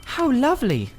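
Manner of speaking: put-on high thrilled girlish voice; a young girl charmed by the rings; delighted girl. A narrator's reading. Polly admiring the colored rings, high and delighted.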